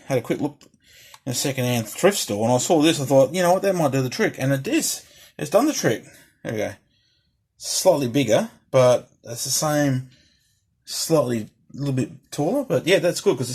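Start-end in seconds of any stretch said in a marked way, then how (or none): none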